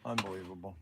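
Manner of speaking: low, soft exasperated mutter; muttered disbelief. Real, not acted. After hanging up on a scam caller, soft exasperated mutter.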